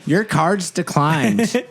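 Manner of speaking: high, drawn-out cashier voice; put-on high customer-service delivery; checkout lady. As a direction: announce it slowly as a mock cashier. Continues the checkout lady's role.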